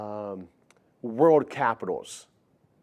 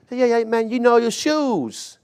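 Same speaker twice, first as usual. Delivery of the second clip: high put-on kid voice, laid back; imitating a teen; 10 semitones higher, 3 dB louder than usual. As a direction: play the cool teenager, much higher. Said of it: "They'd be like".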